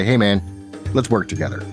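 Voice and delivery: normal talking voice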